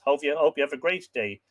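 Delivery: ordinary talk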